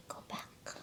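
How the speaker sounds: plain reading voice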